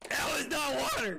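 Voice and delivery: high, shocked yell; real outraged scream. Real, not acted. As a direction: shout it in disbelief at being tricked. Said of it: "That scream just made Summer jump".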